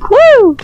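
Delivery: high, loud 'woo' cheer; excited whoop. Real, not acted. Player cheering own jump, excited whoop. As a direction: whoop it sharply upward.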